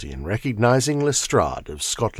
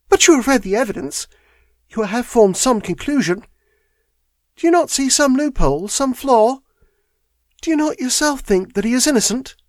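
much higher, urgent pleading; young woman, eager; 14 semitones higher than usual. Acted. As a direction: convince him James is innocent. Miss Turner voiced in story.

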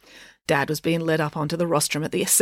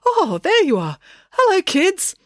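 high, pleased hello; happy greeting; 13 semitones higher, 4 dB louder, slower than usual. A narrator's reading. Dad spots his children in the crowd, happy greeting. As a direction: call out happily, pitch lifted. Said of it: "He waved happily at Joe".

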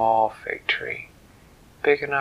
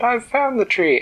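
high, louder, laughing fairy voice; bright fairy; 12 semitones higher, 7 dB louder than usual. Acted. The fairy pats the trunk and cheerfully answers the tree. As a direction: chirp it out, pleased with herself.

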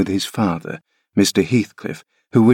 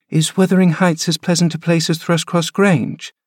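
put-on high, wistful child's question; a frail boy looking back at home; curious boy; 9 semitones higher than usual. Linton asking his nurse about his new home, high wistful boy. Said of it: "he inquired".

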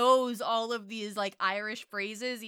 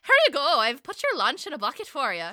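mock cheery mom; 2 semitones higher, 8 dB louder than usual. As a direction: hand it over like an overbright mother. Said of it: "if your mom was like".

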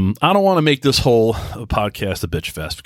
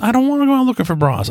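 much higher, faster protesting repeat; higher, quicker exasperated protest; 11 semitones higher, faster than usual. Retold or imitated. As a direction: push back, half laughing, in exasperation. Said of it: "repeated louder".

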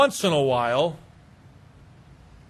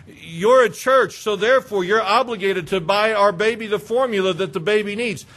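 louder, entitled caller voice; entitled demand; 3 semitones higher, 4 dB louder than usual. Retold or imitated. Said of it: "some of them believe the churches are obligated".

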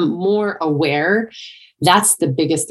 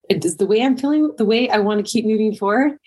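higher-pitched questioning, acted out; self-questioning; 6 semitones higher than usual. Coach checking in with their own feelings, self-questioning. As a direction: pose it to yourself, pitched up.

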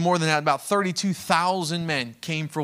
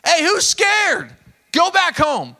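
loud, high, slow shout to the crowd; 11 semitones higher, 6 dB louder, slower than usual. Acted. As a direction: bellow it at the whole army, drawn out. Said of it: "So all these men come".

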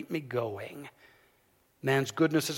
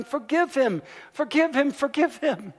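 even higher, louder pleading chant; kept shouting; 11 semitones higher, 5 dB louder than usual. Retold or imitated. Students keep shouting at the principal to spare the boy, higher still. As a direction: cry it out over and over, rising. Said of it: "the students kept shouting".

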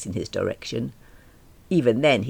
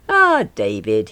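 slow, louder welcoming hail; smiling greeting; 8 dB louder, slower than usual. A narrator's reading. Young Mister Davies greeting David, smiling. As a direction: greet him with a smile. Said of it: "he said, smiling".

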